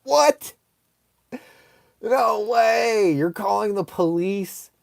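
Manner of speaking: high-pitched incredulous questioning; hammed-up astonishment; mock disbelief. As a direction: act shocked, starting high and easing down. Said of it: "I was like".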